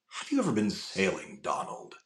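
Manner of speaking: a soft, measured crime boss; Raymond Reddington voice. Retold or imitated. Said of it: "like, Raymond Reddington from The Blacklist".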